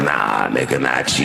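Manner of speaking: low, dismissive aside in retelling; low-pitched, skeptical comeback, acted out; mock disbelief. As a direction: drop way down in pitch to doubt the boast. Acted disbelieving reply to teacher's boast.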